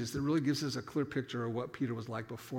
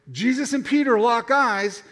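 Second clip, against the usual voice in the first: normal talking voice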